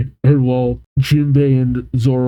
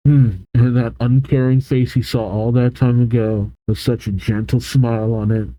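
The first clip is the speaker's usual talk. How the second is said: usual speaking voice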